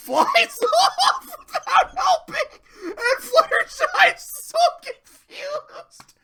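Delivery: high, loud, drawn-out squealing through laughter; cracking up mid-story; real laughing. Real, not acted. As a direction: barely get the words out for laughing.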